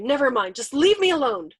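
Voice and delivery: loud, anguished plea; despairing wail. A narrator's reading. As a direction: wail to be left alone. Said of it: "wailed Yossarian".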